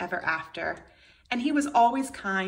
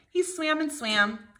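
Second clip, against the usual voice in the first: plain reading voice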